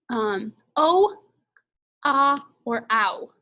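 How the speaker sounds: usual speaking voice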